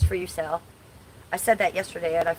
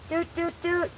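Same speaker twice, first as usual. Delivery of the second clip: playful doot sound; 11 semitones higher than usual. Pretend. Pointing out belly ring.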